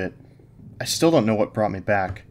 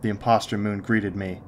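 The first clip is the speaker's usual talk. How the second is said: ordinary delivery, nothing marked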